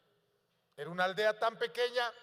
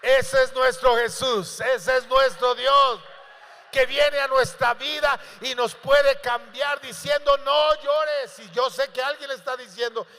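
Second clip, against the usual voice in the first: shouted preaching; 3 semitones higher, 11 dB louder than usual. Acted. Preacher proclaiming Jesus to the church, shouted.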